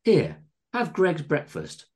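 high, slow, generous offer; doting landlady. A narrator's reading. Missus Tingleberry giving John another guest's breakfast, doting. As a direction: indulge your favourite guest.

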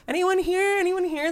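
high-pitched calling out; yelling. Retold or imitated. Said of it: "I'm, like, yelling".

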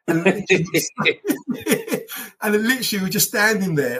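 laughing through the words, voice jumping high. Real, not acted. Telling a friend the funny part, laughing. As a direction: crack up as you tell it.

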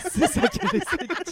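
laughing. Real, not acted. Laughing teasing summary.

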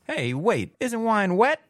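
high, clueless mock question; naive confused question. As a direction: play dumb and puzzled. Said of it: "Is what I would say if I didn't know".

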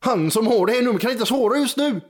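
put-on high, sing-song Gothenburg accent; Gothenburg voicemail voice. Phone answering message to a caller, Gothenburg accent. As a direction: play a recorded message in broad Gothenburg dialect. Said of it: "varför det pratar på göteborgska".

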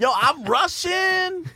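very high, drawn-out comedian impression; slow, squeaky-high boast; Tiffany Haddish. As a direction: stretch it out with hammed-up swagger. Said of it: "did fucking Tiffany Haddish".

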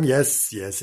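ordinary delivery, nothing marked

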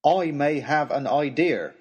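drawn-out humble servant; disguised servant. A narrator's reading. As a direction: offer it carefully, in disguise. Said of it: "remembering my disguise".

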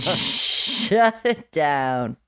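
long, playful drag on every word; drawn-out slogan. Pretend. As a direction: stretch it out very slowly.

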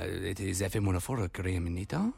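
a Spanish twang on English, drifting French; attempted Spanish accent. Shown as a demonstration. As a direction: try the Irish-Spanish voiceover accent. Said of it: "talk like this ... That's more French".

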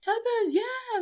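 high, loud shouted call of the brand; mock photographer shout. Photographer calling out her shoe brand, loud shout.

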